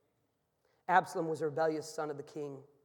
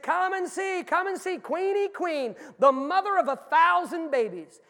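high, loud circus barker's call; booming, high-pitched sideshow pitch; carnival barker; 11 semitones higher, 10 dB louder than usual. Acted. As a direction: holler to draw the midway crowd in, stretching the words. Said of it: "standing at the top of the ramp, and he said".

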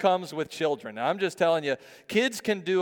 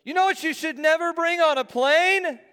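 high, loud mimicked kid voice; imitated boy's shouted question; five-year-old son; 13 semitones higher, 6 dB louder than usual. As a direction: ask it at full volume for the whole plane to hear. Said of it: "And he goes".

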